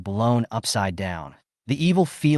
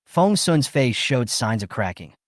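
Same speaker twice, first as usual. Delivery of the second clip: ordinary delivery, nothing marked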